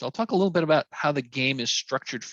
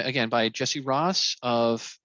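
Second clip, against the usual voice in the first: ordinary talk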